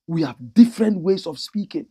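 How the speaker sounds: ordinary delivery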